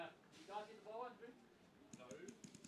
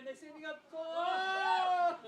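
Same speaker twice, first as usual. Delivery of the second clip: shouting across the field; excited shout; 6 semitones higher, 20 dB louder, much slower than usual. Real, not acted. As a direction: yell it out loud. Excited call at the game.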